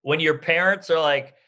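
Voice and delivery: ordinary talk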